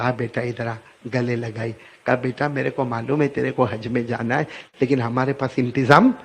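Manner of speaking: soft, low and gentle; tender mother. Acted. Mother softly comforting her son after her anger. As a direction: soothe the child as you hug him. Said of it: "پھر ماں کو رحم آیا".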